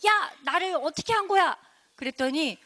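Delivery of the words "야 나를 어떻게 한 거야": higher-pitched startled protest; acted storybook shock. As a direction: blurt it out in alarm at what was done. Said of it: "거만 씨가 너무 놀래가지고".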